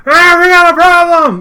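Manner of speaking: high loud cry of alarm; alarmed yell. Acted. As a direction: bellow the bad news. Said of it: "he's like".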